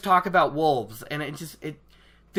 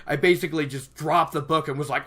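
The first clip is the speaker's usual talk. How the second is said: usual speaking voice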